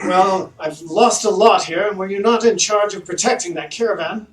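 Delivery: high, loud haughty noble voice; pompous mayor. Acted. Mayor accusing the party of ruining his silk, haughty and loud. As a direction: look down your nose at them. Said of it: "he's like".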